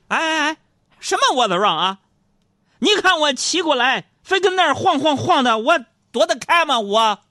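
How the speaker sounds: high, loud mock-indignant protest; defiant comeback. Foreign cyclist blaming the pedestrian, loud defiance. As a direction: fire back loudly, refusing to back down. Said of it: "见状不甘示弱， 瞪大眼睛在那说".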